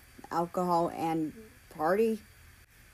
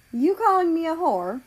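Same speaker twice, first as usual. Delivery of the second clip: indignant, higher and a bit louder; offended retort; 8 semitones higher, 4 dB louder than usual. A narrator's reading. Ex accused of flirting for the team, indignant. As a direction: take offence at the insult.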